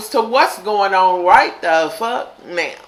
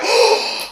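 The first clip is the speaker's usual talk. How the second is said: shocked gasp; 11 semitones higher than usual. Retold or imitated. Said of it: "I was like".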